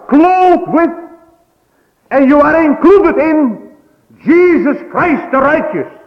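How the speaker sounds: fervent preacher's cry; preaching at full force. Acted. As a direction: drive it home from the pulpit.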